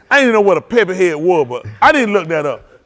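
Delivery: ordinary delivery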